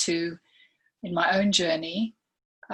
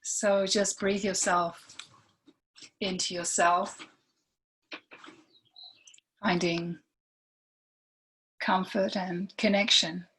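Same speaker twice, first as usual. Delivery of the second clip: guided meditation; 3 dB quieter than usual. Real, not acted. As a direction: lead gently through it, leaving long silences between phrases.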